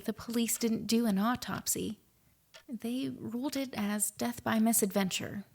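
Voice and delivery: ordinary talk, nothing marked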